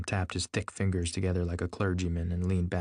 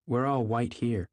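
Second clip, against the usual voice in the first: plain reading voice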